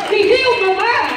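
"He healed my mind" cried out high and loud; shouted declaration. Real, not acted. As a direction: proclaim it at full strength. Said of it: "repeated declaration".

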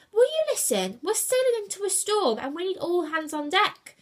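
high, loud, exasperated shout. A narrator's reading. Julius warns idle crew of storm, shouted. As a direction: shout to make them listen. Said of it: "Julius shouted".

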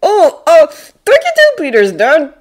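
put-on high, hesitant boy voice; loud youngster's thanks; polite kid. A narrator's reading. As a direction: pipe up politely to a friend's dad. Boy thanks friend's supposed dad.

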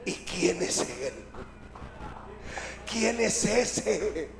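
low, soft, awed questioning; murmured, stretched disbelief; hushed wonder. Acted. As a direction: ask it secretly among friends. Disciples huddle, wondering in secret.

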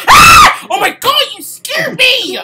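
genuinely terrified yell; startled scream. Real, not acted. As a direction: scream then shout in fright. Jump scare from monster.

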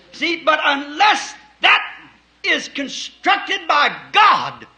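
slow, high shouted preaching; rising to a shouted climax; fiery preaching. Real, not acted. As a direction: hammer the point home from the pulpit.

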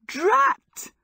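very high, loud cry of annoyance; exasperated yelp. A narrator's reading. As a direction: burst out with a high-pitched curse. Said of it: "she cried".

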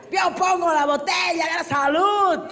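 loud, drawn-out boast to the party; high, slow celebratory shout; proud drunk. Acted. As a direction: play a drunk rallying everyone to drink. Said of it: "el borracho asumido".

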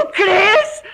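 sudden loud, high cry of disbelief; startled shout. Acted. Terina blurts out to the old wanderer, loud and high. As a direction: blurt it out loud without meaning to. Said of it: "Pero si has dado un grito".

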